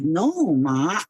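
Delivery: ordinary talk, nothing marked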